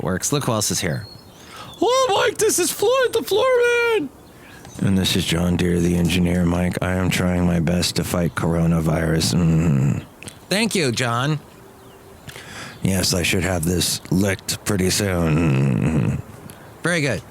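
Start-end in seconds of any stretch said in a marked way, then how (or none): 1.8-4.1 very high, squeaky little character voice; squeaky puppet voice. Acted. Host chatting with his high-voiced puppet guest. 4.8-10.0 deep character voice. Acted. Engineer character answering the host in a low voice. 12.8-16.2 low, slow robotic engineer voice; deep character voice. Acted. Engineer character answering the host in a low voice.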